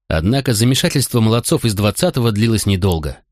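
ordinary delivery

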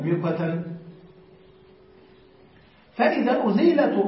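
usual speaking voice

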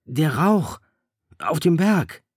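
high, loud cry of alarm; the boy suddenly sees the danger. A narrator's reading. Boy crying out to the others about the smoke, loud alarm.

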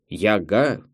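"Яга" stressed on the second syllable; funny misplaced stress. A narrator's reading. Director puzzling over the film's title, stressing the end of "Яга". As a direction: say "Яга" as a question, leaning on the final "га". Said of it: "смешно произносит это слово с ударением на второй слог".

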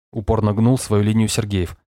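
usual speaking voice

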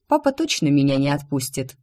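ordinary delivery

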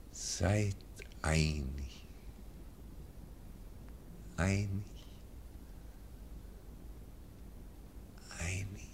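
fading last words. Acted. Dying last words repeated.

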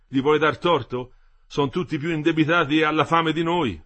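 loud, insistent husband's voice; Metello, worked-up worker. A narrator's reading. Husband warns his wife about indebted strikers, insistent and loud. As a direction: press the grim point, animated.

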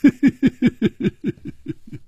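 high, long run of laughter trailing away; hearty laugh. A narrator's reading. Old man laughing on the stone steps, high trailing laugh. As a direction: laugh out as you walk off. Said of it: "在石阶上笑道".

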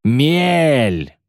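high, drawn-out warning call; alarm cry. A narrator's reading. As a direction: call out the danger, stretching it. Lookout shouts danger. (протяжный крик тревоги)

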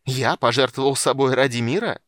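loud, incredulous sneer, rising at the end; mocking echo. A narrator's reading. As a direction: throw his words back at him with scorn. Archmage mockingly repeats nephew's words.

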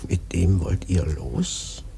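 slow ominous whisper. Acted. Old prophet whispering a dark warning to the sailors.

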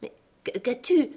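stammering. A narrator's reading. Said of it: "Il bégaya".

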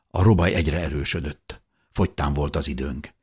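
usual speaking voice

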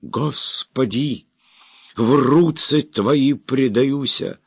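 high, slow drawn-out crying out; anguished cry. Acted. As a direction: cry out to God in agony. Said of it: "будет кричать и кричать".

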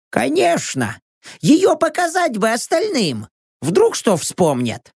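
high-pitched companion voice; Rox. A narrator's reading. Rox suggests showing the photo around, high character voice.

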